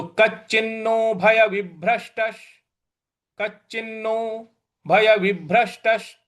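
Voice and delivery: कच्चिन्नो भय विभ्रष्टश with the half श struck firmly; stress on the sh. Shown as a demonstration. Teacher to students, pressing the half श. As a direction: lean into the श of विभ्रष्टश. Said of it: "आघात देना है".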